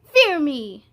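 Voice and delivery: loud menacing demand; threatening command. Acted. Limbo threatening Alma, loud menace. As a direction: boom it at her.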